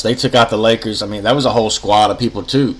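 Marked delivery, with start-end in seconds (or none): none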